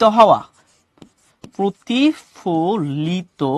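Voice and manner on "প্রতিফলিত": প্রতিফলিত very slowly, broken into syllables. Shown as a demonstration. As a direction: dictate প্রতিফলিত piece by piece, softly. Teacher spells out meaning by syllables.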